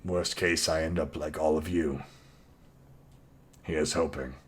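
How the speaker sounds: reluctant, low, quiet mutter; a grudging fighter, voiced low and soft; low grumbling voice. GM voices the grumpy Ronald.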